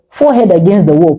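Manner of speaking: ordinary delivery, nothing marked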